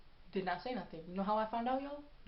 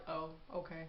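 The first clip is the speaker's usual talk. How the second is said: unimpressed; 3 semitones lower, 8 dB quieter than usual. Retold or imitated. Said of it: "That's all you have to say?".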